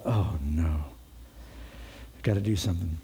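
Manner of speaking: low, sinking realization; understated, low-pitched dismay; dismayed thought. Retold or imitated. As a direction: voice her dismay low, as if to herself. Abigail's alarmed thought, voiced by preacher.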